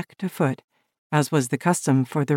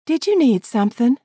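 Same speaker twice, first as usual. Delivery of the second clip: higher, light woman's question; young woman voice; 9 semitones higher, 4 dB louder than usual. Acted. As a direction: ask gently as the woman. Woman peers into cabin.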